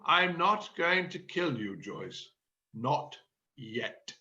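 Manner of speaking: low, soft, threatening; menacing calm. Acted. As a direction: keep the threat quiet and low. Gordon suppressing rage.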